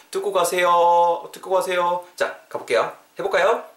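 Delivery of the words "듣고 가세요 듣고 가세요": higher, drawn-out calling after someone; mock-beg them to stay, stretching the endings; louder sing-out plea to departing students; playful plea.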